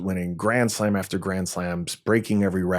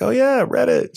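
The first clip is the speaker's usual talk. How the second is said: much higher mock "oh yeah", faking familiarity; put-on polite enthusiasm, pitched way up; feigned recognition; 5 dB louder than usual. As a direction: pretend you've totally heard of it. She pretends to know Reddit.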